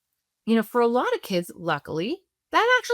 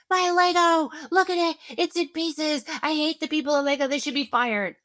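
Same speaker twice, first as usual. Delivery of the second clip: put-on much higher kid voice, wounded and outraged; upset child; 8 semitones higher than usual. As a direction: wail it like an eight-year-old whose tower broke. Upset child answering parent.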